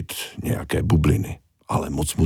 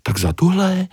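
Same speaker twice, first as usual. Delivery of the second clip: high little-fish question; 13 semitones higher than usual. Acted. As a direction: squeak it as the tiny fish. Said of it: "zeptala se malá ryba".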